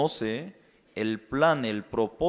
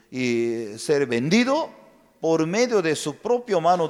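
usual speaking voice

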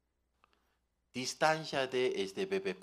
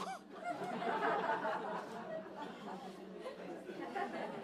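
breathy laugh; 8 dB quieter than usual. Real, not acted. Laughs at own joke.

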